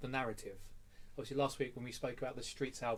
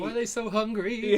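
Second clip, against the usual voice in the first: mock charity wail; 9 semitones higher, 11 dB louder than usual. Mocking the [name] ad lib.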